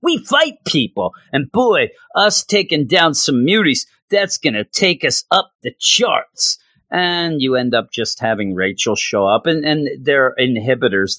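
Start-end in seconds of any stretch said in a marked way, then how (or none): 0.0-6.6 hammed-up dim henchmen boasting; dumb thug voice. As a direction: play the dim cyberpunk goons. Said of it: "who then say".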